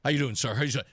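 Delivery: firm handshake greeting. Retold or imitated. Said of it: "look me in the eye".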